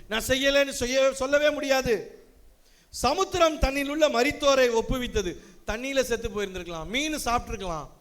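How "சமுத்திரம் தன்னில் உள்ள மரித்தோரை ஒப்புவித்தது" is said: solemn, lifted reading voice; preacher reading Revelation aloud to the congregation, solemnly; scripture reading. Acted. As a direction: proclaim the vision from Revelation. Reads scripture.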